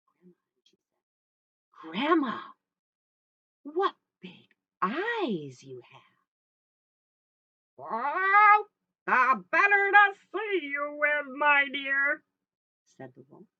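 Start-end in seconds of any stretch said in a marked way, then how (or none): 1.7-6.3 lightly voiced girl, slow wonder; wondering little girl. Little Red peers at Grandma, slow and wondering. 7.8-12.2 loud, high, slow fake granny; wolf posing as Grandma. Wolf fools Little Red from bed, high and loud.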